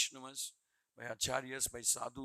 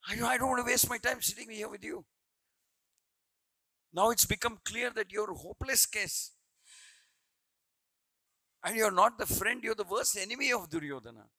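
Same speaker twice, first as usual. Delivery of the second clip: louder, fed-up parting shot; exasperated rebuke; 7 semitones higher, 7 dB louder than usual. Retold or imitated. Wise adviser walking out on the stubborn king, exasperated. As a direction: storm off while telling him he's hopeless. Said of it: "He says and goes away".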